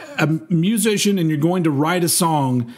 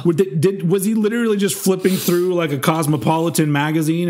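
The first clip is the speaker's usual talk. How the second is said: usual speaking voice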